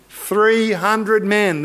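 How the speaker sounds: THREE HUNDRED MEN drawn out slowly; dramatic reveal. Real, not acted.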